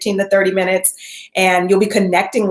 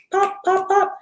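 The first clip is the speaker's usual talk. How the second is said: mock popping pills; 13 semitones higher, slower than usual.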